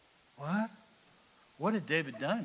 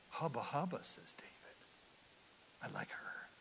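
whispered, softer; lustful whisper; 4 semitones lower, 15 dB quieter than usual. Acted. King David eyeing Bathsheba, leering whisper. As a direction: breathe it like a leering aside.

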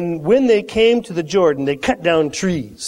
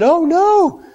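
alarmed; 10 semitones higher, 3 dB louder than usual. Acted. Reacts as axe head flies off.

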